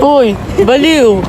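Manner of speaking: high loud teasing yell; playful shouting. Real, not acted. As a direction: yell it at a friend. Teasing shouts between friends.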